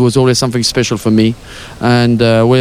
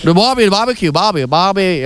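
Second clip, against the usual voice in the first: higher, put-on Aussie accent; Australian accent; 3 semitones higher than usual. Guest playing an Australian for the interviewer, mock Aussie accent. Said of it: "Do an Australian accent".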